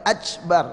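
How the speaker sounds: "ajbar" with a ch sound in place of k; K turned to CH. Shown as a demonstration. Teacher showing a takbir gone far off, k as ch. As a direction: soften the k of Akbar into "ach-bar". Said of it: "Apalagi bacanya ajbar".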